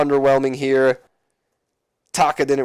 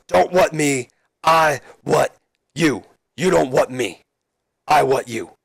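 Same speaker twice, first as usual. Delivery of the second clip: slow, emphatic telling-off; mock scolding; 3 semitones higher, slower than usual. Austin putting down the heckling crowd, mock stern. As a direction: space out the words as a mock warning.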